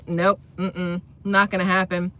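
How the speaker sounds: ordinary talk, nothing marked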